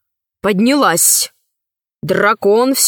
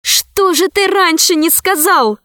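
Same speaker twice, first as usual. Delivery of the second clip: exclaiming; 7 semitones higher than usual. Acted. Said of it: "воскликнула Алиса".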